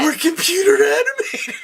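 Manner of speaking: high playful mock claim; high joking voice. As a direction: say it like a silly joke. Joking about being animated.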